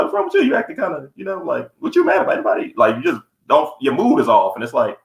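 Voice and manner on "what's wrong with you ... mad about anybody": imitating the boyfriend. Imitating a boyfriend checking on his girl, mock concern. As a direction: lightly mimic a boyfriend prying. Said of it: "They be like".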